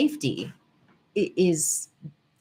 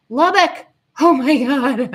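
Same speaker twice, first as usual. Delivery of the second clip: delighted surprise; 8 semitones higher, 9 dB louder than usual. Real, not acted. Recognizes viewer's hometown excitedly.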